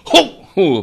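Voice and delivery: slow, loud shouted roar; great shout. Shown as a demonstration. Showing how a master startles a student, loud slow roar. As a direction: bellow it out to startle. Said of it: "大喝大骂".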